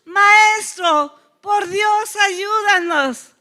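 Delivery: high, loud pleading shout; loud cry for help. Acted. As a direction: call out desperately to someone far off. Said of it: "a voz alta".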